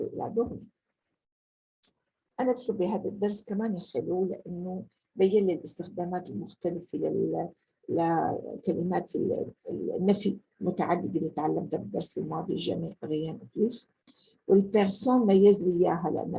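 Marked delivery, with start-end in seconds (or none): none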